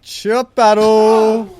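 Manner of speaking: slow, loud ring announcer call; drawn-out announcer call. Real, not acted. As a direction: stretch it like a hype man. Announcing a chop war.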